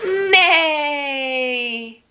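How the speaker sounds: long drawn-out playful neigh; stretched-out mock whinny; horse neigh. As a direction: imitate a horse for small children. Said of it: "Can you say it with me?".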